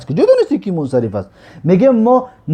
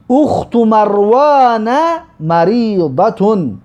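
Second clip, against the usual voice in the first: reads the Arabic phrase very slowly, word by word, slightly higher; slow model reading; 4 semitones higher, much slower than usual. Shown as a demonstration. Teacher models the Arabic sentence for students, very slow and deliberate. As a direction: spell it out slowly so the class can repeat. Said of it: "اینا تکرار کنید".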